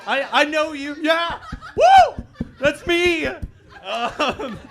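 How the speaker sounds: high, loud whooping at himself; excited self-cheer. Acted. Comic cheering for himself as the Colorado fan, excited whoop. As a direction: hype yourself up.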